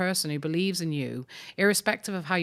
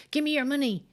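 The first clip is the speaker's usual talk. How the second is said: put-on tough kid threat; 8 semitones higher, faster than usual. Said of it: "I was the kid who was saying".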